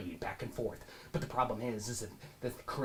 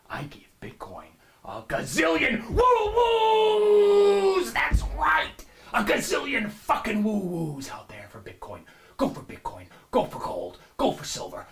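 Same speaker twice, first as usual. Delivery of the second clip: screaming, high-pitched stock guru pitch; manic money show host; 11 dB louder than usual. Acted. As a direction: sell it like a frantic TV finance host. Hyped host shouting endorsement.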